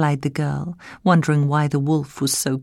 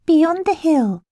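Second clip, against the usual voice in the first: put-on high, innocent child reply; innocent girl voice; 4 dB louder than usual. A narrator's reading. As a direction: say it trustingly like a little girl.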